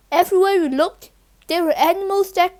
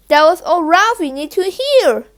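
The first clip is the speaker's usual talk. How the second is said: ordinary delivery, nothing marked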